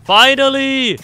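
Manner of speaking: high, loud cry of relief; triumphant shout. Real, not acted. Player succeeding after a long fight, high relieved shout. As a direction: let it burst out high and loud.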